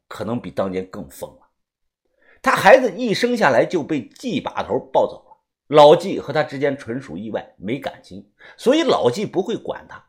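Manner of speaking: usual speaking voice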